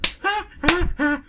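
loud high laugh; high burst of laughter. Real, not acted. Laughing at own joke.

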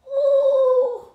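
very high loud squeal of amazement; high ooh. Acted. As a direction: marvel at the mess. Reaction to mess.

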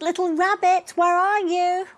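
high, loud worried father's call. Acted. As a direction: call across the snowy garden. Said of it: "he called".

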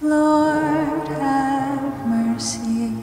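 normal talking voice

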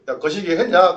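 dialect call-out. Retold or imitated. Teacher voices dialect exchange.

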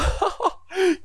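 shrill burst of laughter; high excited laugh. Real, not acted. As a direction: laugh out in triumph. Laughs after the kill.

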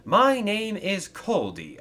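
high, loud, slow self-introduction; happy introduction. Acted. Engine introduces himself to Thomas, happily. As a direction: announce your name happily. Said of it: "said the engine happily".

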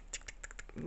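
soft unvoiced tick-tick clicks; quick breathy ticking noise; gerbil chickering. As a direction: click your tongue like a chattering gerbil. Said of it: "starts making this chickering noise".

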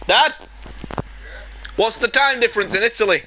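calling out. Real, not acted. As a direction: shout a question through to another room. Calls to father in other room.

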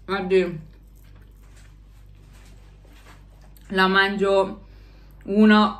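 chewing while talking; speaking through a mouthful; mouth full of pasta. Real, not acted. Seen 4: Holds pasta from the start; eats at 4.8, mouth full through "Oddio.

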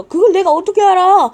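fed up, spit the question back; 그걸 내가 어떻게 알아 as an annoyed shout; exasperated retort. Acted. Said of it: "몇 번을 들어".

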